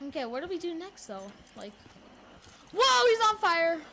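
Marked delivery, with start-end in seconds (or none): 2.7-3.8 loud, high, stretched whoa of amazement; excited shout. Real, not acted. Gamer yelling at a burning zombie, excited shout. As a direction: blurt it out, stunned.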